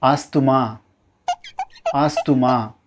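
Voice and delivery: ஆஸ்துமா as AAS-thu-maa; mispronounced asthma. Shown as a demonstration. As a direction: sound the TH in ஆஸ்துமா. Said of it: "இது தவறான உச்சரிப்பு".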